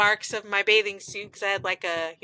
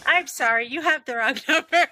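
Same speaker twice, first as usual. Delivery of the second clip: quick, higher, breaking into laughter; laughing joke brush-off; 4 semitones higher, faster than usual. Pretend. Friend joking about replying to a nickname, laughing. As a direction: play a brush-off and crack up on it.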